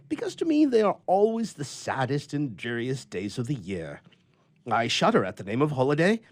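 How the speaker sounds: a lonely grouch airing his gripes; grumpy old bachelor. A narrator's reading. Bachelor Bluff voiced in story.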